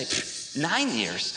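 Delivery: low, soft scoff with a puff; mock dismissive scoff. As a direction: play an unimpressed listener. Imagined listener scoffs.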